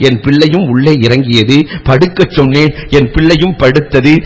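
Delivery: ordinary talk, nothing marked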